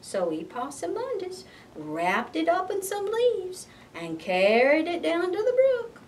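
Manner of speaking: ordinary delivery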